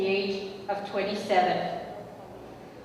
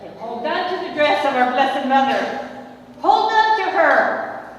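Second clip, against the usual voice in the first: building from a firm call to a booming shout; his booming preaching; 5 semitones higher, 6 dB louder than usual. Retold or imitated. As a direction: echo her husband's call to the crowd. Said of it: "I'm going to tell you what he used to say".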